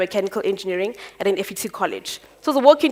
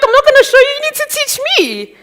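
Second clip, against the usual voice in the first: pitched way up and louder, a cheeky comeback; cheeky retort; 8 dB louder than usual. Retold or imitated. Young apprentice telling the boss to teach her, cheeky and high. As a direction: throw it back at her, higher and bolder.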